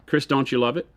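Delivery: usual speaking voice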